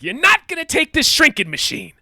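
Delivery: very high, loud strained yell; hammed-up furious tough-guy challenge; Ray Liotta. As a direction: shout a defiant threat at full pitch. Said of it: "Ray Liotta's like".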